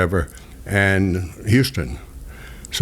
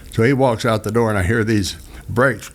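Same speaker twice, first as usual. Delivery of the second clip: ordinary talk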